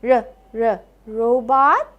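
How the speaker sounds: r, r, then robot; phonics sounding out. Shown as a demonstration. Teacher checks robot for the r sound, sounding out. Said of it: "does this have the r sound".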